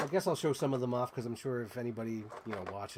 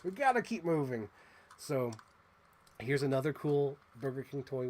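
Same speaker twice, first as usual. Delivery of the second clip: usual speaking voice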